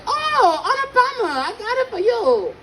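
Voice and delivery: falsetto mock Chinese-accented greeting. Server recognises her regular, very high Chinese accent. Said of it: "I'm so good at that accent".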